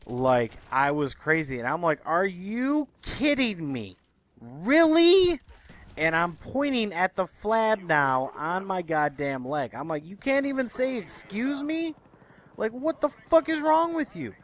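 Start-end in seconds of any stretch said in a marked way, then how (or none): 2.1-5.4 slow, high-pitched disbelief; exasperated, rising challenge; incredulous outrage. Retold or imitated. Acted outraged confrontation with seatmate. 10.1-14.4 high-pitched, exasperated telling-off; incredulous, high-pitched confrontation; indignant scolding. Retold or imitated. Acted confrontation with seatmate.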